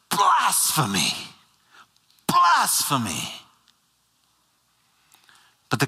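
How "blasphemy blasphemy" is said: high, drawn-out cry of outrage; mock outraged yell. High priest crying out blasphemy at Jesus's answer, outraged. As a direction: cry it out as if tearing your clothes. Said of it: "he yells out".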